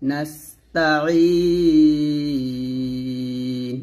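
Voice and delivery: নাস্তাইন chanted very slowly, the ee held long before a still নুন; drawn-out recitation. Shown as a demonstration. Teacher shows how to end the verse, long held chant. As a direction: stretch নাস্তাইন in slow Quran chant. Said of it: "একসাথে পড়ি".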